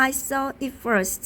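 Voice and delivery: ordinary delivery, nothing marked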